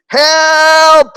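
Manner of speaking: high, long, loud cry for help; imitated child's scream. Toddler calling for rescue from injections, screaming. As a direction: holler it like a desperate toddler. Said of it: "dia teriaknya tuh saya nggak pernah".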